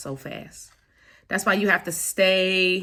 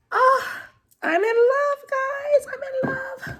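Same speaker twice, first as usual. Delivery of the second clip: high squealing delight over the gold cards; excited squeal; 3 dB louder than usual. Real, not acted. Unboxing a gold tarot deck for viewers, high excited squeal.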